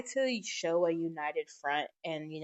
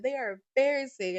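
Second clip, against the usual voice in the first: higher and louder fed-up complaint; a dad fed up with his kids, whining louder; exasperated brother; 7 semitones higher, 6 dB louder than usual. Retold or imitated. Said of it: "my brother is like".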